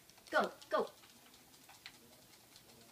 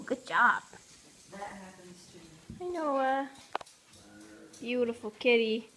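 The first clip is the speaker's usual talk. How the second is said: ordinary talk, nothing marked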